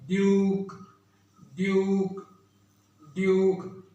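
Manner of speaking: "ड्यूक" with a long "yoo"; slow teaching repetition of "ड्यूक"; long vowel. Shown as a demonstration. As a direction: stretch the vowel in each "ड्यूक", pausing between. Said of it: "लंबी हो जाएगी".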